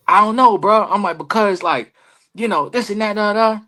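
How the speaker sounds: retold high-pitched protest; flustered excuse. Wrestler asked how he messed up, flustered.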